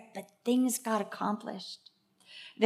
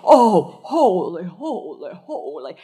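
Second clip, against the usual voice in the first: awed prayer; 8 dB louder, slower than usual. Retold or imitated. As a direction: cry out then sink into awe. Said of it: "as if she saw his throne".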